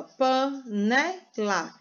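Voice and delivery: "panela" slowly split into pa, ne, la; teacher sounding out "panela" in pieces, pa, ne, la; syllable by syllable. Shown as a demonstration. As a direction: separate each of the three syllables in "panela". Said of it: "Se ela tem três sílabas".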